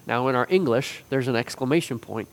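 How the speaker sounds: usual speaking voice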